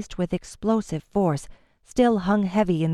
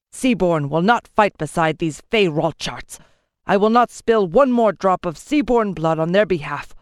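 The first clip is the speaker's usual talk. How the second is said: louder, hard-edged vow; angry declaration; 4 dB louder than usual. A narrator's reading. Lord to the king, angry vow. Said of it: "He turned to the king and declared".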